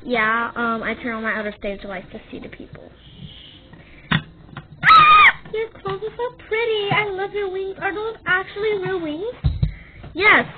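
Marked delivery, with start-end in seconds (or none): 4.1-9.0 very high loud squeal into gushing; fangirl scream. Acted. Fan sees the YouTuber's outfit, high loud squeal. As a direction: shriek with delight at her clothes.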